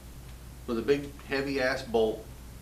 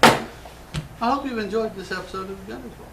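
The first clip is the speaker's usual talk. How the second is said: mock sign-off; 9 semitones higher, 4 dB louder than usual. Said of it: "No, no, I'm kidding".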